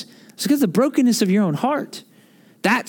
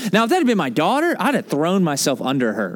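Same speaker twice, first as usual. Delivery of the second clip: normal talking voice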